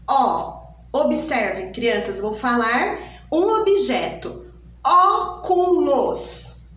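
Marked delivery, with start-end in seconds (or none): 4.8-6.6 slow "Óculos" with a wide open Ó. Shown as a demonstration. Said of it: "Observem o som da letra O". ("Óculos" com O aberto, devagar)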